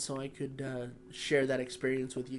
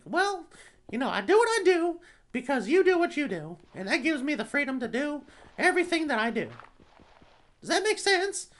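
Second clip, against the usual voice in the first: very high, loud cartoon mouse voice; squeaky falsetto, insistently friendly; Mickey Mouse; 12 semitones higher, 7 dB louder than usual. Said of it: "Oh, it's Mickey Mouse".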